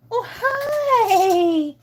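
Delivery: high, loud, drawn-out squeal of delight; gushing pet greeting. Real, not acted. Owner greeting her dog as she arrives, gushing squeal. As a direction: greet your dog with a long happy squeal.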